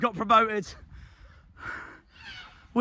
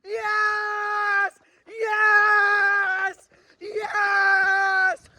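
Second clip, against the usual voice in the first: ecstatic shouting; 10 semitones higher, much slower than usual. Real, not acted. Fan's team just got promoted, ecstatic shouting. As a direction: scream yes through tears.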